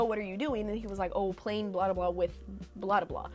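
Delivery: ordinary talk, nothing marked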